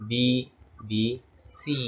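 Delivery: BBC as separate, drawn-out letters in "বিবিসি"; slow letter-by-letter "বিবিসি", gaps between letters; spelled out letter by letter. Shown as a demonstration. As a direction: say "বিবিসি" slowly, one letter at a time. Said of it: "letter by letter আমরা উচ্চারণ করব".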